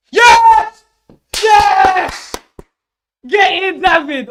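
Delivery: screaming yes at the top of his lungs; real ecstatic celebration yell; ecstatic shout. Real, not acted. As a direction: roar with joy at the save. Keeper saves penalty, celebrating.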